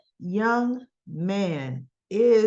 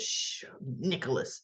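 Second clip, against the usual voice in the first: a much softer, lower hush; 9 semitones lower, 10 dB quieter than usual. Retold or imitated. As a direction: soothe him quietly. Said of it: "He goes".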